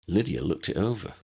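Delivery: usual speaking voice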